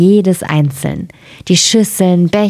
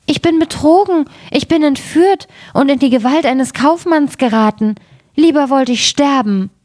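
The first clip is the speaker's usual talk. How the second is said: high-pitched dismay of a princess; storyteller's high, alarmed princess; frightened outcry; 8 semitones higher than usual. A narrator's reading. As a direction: cry out as if betrayed and trapped. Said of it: "rief sie erschrocken".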